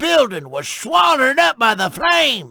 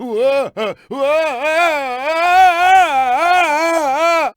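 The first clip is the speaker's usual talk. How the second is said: long whooping laugh; 5 semitones higher than usual. Acted. Tow truck riding the tire, long whooping laugh. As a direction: cackle and holler at once.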